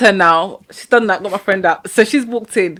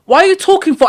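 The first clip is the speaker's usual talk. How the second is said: retold impatient auntie; 8 semitones higher, faster than usual. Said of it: "the auntie that was doing it for us was like".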